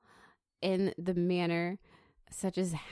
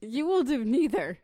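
loud, higher, forceful refusal; raised-voice mock command; mock aggressive; 4 semitones higher, 5 dB louder than usual. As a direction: lay down the law, playfully aggressive. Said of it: "that got really aggressive".

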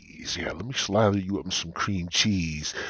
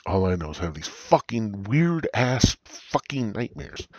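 usual speaking voice

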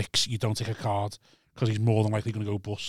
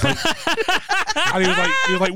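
laughing through the words; giggling as she tells it; 4 dB louder, much faster than usual. Real, not acted. Laughing while telling friends the casino story.

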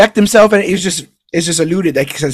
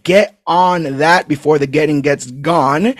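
ordinary talk

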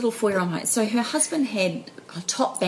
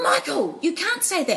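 much higher, sharp scolding mum; scolding mother; 11 semitones higher than usual. Retold or imitated. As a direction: snap at the child in front of guests. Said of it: "the mother turns around and goes".

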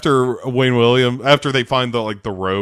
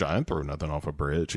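muttered denial; 8 semitones lower, 12 dB quieter, faster than usual. Retold or imitated. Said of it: "they're like".

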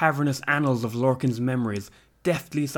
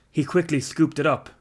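ordinary delivery, nothing marked

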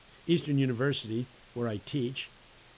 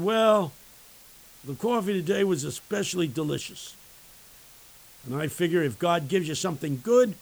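high, loud, hearty retold reply; exuberant homeless man; 4 semitones higher, 7 dB louder than usual. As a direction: proclaim it loudly like a cheerful street character. Homeless man's acted loud reply.

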